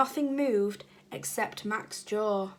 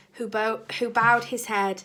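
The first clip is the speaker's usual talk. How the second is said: ordinary delivery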